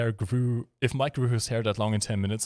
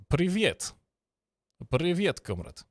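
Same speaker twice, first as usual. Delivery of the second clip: high-pitched, lightly hammed Russian greeting; Russian accent; 8 semitones higher, slower than usual. Shown as a demonstration. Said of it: "in a Russian accent?".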